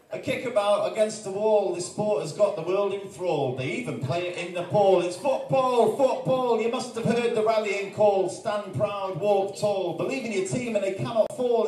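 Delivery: rhythmic chant-like spoken word with big football refrains; rally the room like a terrace chant; performed poem. Acted. Poet performing his football poem to the audience, chanting the refrain.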